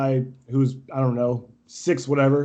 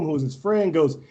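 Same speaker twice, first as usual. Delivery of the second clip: ordinary talk, nothing marked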